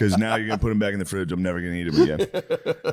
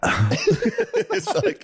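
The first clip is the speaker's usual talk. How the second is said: mock sip noise. Mimicking loud sip.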